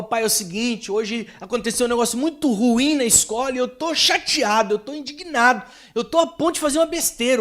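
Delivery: voz de menino agitado, bem mais aguda e subindo; menino indignado. Retold or imitated. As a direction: imitando um filho revoltado que desabafa. Menino indignado fala com o pai. (menino chateado contando ao pai, voz aguda e indignada)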